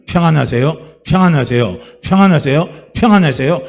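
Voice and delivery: loud, ringing call of peace; loud blessing. Real, not acted. Pastor blessing the congregation, loud and ringing. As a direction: proclaim peace over them.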